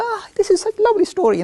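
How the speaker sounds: put-on very high, loud gushing child; delighted child voice. Children telling the storyteller they love the story, very high. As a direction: swoon like an enchanted kid.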